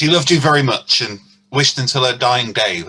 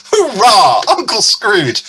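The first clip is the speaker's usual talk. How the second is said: loud, higher cry of joy; a happy hurrah for the guest; excited cheer; 7 semitones higher, 5 dB louder, slower than usual. Acted. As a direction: burst out with delight. Joyful welcome of the uncle.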